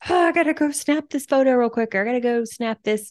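high, hurried fretting; hammed-up panicky rush; mock frazzled. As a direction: act out a harried scramble to get content. Mimics frantic rush to post content.

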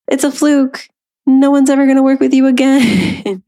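high, sustained inner-critic voice; little inner voice. Acted. Inner doubt telling her it's a fluke, little voice. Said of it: "that little voice pop up".

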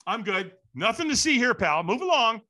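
breezy lie to send him away; fake I'm fine. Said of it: "what do we say? We lie".

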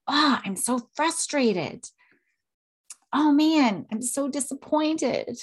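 modeled frustration and disappointment. Shown as a demonstration. Parent modeling frustration for the kids, drawn-out and let down. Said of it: "So we are demonstrating that emotional literacy".

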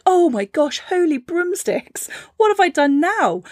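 blink at the bright world; dazzled seed. Acted. Seed breaking into the spring light, high and amazed.

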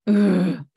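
short, loud groan; disgruntled groan. Real, not acted. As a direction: groan at the bad news. Groans at own hip injury.

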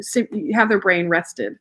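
usual speaking voice

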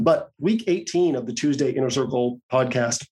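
normal talking voice

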